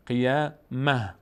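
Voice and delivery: قیامه drawn out slowly, closing on h; slow syllables in قیامه, no t at the end; pausal h ending. Shown as a demonstration.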